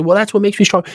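high, mock-proud voice; mocking the listener. Host mimicking a proud listener, high mock-proud voice.

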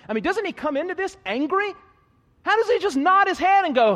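loud, high, slowing preacher's build-up; preaching at full force. Acted. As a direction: drive it home to the congregation, climbing.

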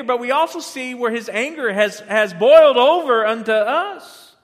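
loud, rising pulpit preaching; fiery sermon voice, pushed hard; preaching at full force. Acted. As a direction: drive the point home to the congregation. Preaching on God's anger.

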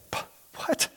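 very soft, speechless sputter; stunned. Acted. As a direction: act dumbfounded, almost no voice. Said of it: "stupefy. Like".

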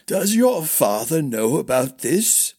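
put-on a forgetful old knight squinting; doddering old man. A narrator's reading. Old master Crushmore voiced.

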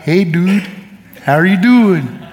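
a light Alaskan native slur, words run together; slurred Alaskan native accent. Retold or imitated. Preacher showing his congregation his slurred Alaska native talk. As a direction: imitate the slurred Alaska native way of talking, loosely. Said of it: "They sort of slur their words a little bit".

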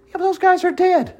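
a doubter in the crowd objects in a high voice; mock doubter. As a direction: pipe up as the skeptic. Voicing a doubter.

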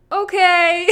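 high, loud sing-song okay; mock serene okay. A mocked Zen mom smiling at her child, in a high, loud sing-song voice. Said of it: "just smile and say".

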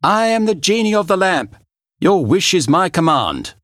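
grand magical proclamation; genie voice. Acted. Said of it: "a gigantic red woman rushed out".

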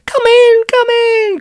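very high, loud, gushing greeting; overenthusiastic welcome. Acted. Music teacher welcomes the children in, gushing and high. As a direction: sing out far too keenly. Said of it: "cried Mister Day with too much enthusiasm".

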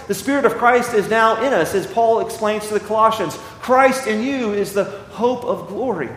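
normal talking voice

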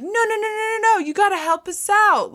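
high, rushed no no no; frantic pleading. Retold or imitated. Retelling the twins begging Dean, high mock panic. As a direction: beg him to help, pitch jumping up. Boys pleading in retold scene.

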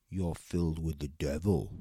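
ordinary delivery

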